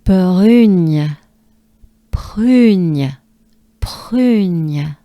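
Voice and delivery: "prugne" with a throaty R and nasal gn; French prugne. Shown as a demonstration. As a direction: press the lips for "prugne". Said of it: "Let's try".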